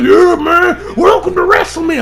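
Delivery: high, loud dumb party dude; mock him as a brainless party bro; hammed-up frat guy greeting; dudebro mock voice. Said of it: "He's like".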